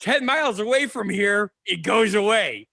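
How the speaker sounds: usual speaking voice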